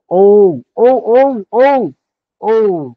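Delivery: high loud calls of o; calling out. Shown as a demonstration. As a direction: shout it across a field. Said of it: "गंभीरता किंवा आरोळी".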